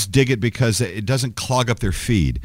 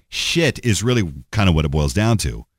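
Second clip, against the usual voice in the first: normal talking voice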